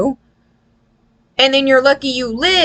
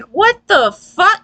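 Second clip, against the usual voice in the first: mock baffled outburst; 8 semitones higher, slower than usual. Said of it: "And you're just like".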